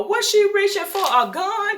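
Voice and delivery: high panicked mock yell; startled party guest yelling; retold yell. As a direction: shout it like someone spotted a weapon. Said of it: "uh, somebody yelled".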